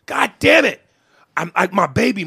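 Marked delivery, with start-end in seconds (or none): 0.0-0.8 higher, louder frustrated outburst; sudden higher, louder curse of frustration; exploding. Retold or imitated. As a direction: blow up in frustration. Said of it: "I explode".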